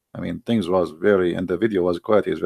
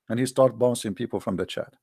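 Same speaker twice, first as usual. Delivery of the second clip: normal talking voice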